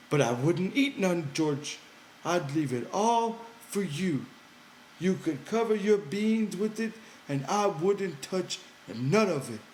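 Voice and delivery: high, eager simple-minded man; Lenny's childlike voice. Acted. Lenny coaxing George by the fire, high and pleading. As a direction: pitch it up high, pleading and earnest.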